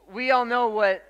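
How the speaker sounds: ordinary talk, nothing marked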